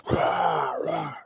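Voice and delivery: big imitated lion roar; drawn-out animal roar. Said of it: "you hear this".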